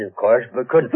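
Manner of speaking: ordinary talk, nothing marked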